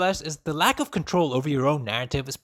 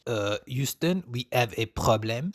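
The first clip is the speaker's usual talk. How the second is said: mock French accent, slow and low; 6 semitones lower, slower than usual. Shown as a demonstration. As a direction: put on a silly French accent. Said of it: "I can do French".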